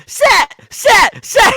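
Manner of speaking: put-on high, loud childish chant of a name; little girl cheering. Little girl cheering on her champion with a gleeful chant. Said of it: "she's still going like".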